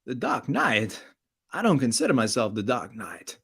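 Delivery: halting Walken-style Batman voice; Christopher Walken. Retold or imitated. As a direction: Walken playing Batman, odd pauses. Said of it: "I'm gonna have to do the impression".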